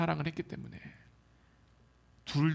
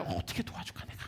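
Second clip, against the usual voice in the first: soft unvoiced whisper of a watching parent; 11 dB quieter than usual. Parent quietly wondering how to help the child, whispered.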